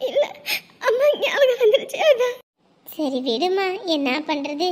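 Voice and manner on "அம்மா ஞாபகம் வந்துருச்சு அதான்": higher, openly sobbing. Acted. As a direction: let the tears break through. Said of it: "இப்படி அழுதுட்டு இருக்க நீ".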